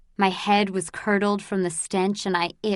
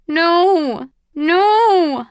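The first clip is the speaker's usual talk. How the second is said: much higher, loud desperate No; anguished cry; 9 semitones higher, 8 dB louder than usual. Acted. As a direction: cry out in denial. Grieving mother refuses baby's death.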